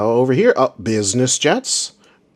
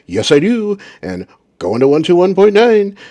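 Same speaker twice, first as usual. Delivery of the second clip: higher, louder, cocky readback; smug pilot; 9 semitones higher, 6 dB louder than usual. Retold or imitated. Mocking the smug pilot's readback, higher and louder. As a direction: play the pilot full of himself. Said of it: "smug, smug person he was before".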